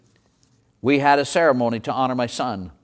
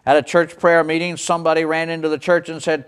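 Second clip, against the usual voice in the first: ordinary talk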